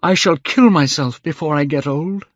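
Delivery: high, agitated reproach; angry outburst. A narrator's reading. Dorian red-faced at the painter, agitated and angry. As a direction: pour out the hurt, pitched high. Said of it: "he seemed quite angry".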